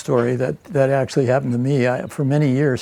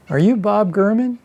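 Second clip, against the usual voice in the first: higher-pitched Southern lady voice, asking; little old Southern lady; 6 semitones higher than usual. Retold or imitated. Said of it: "a little old Southern lady".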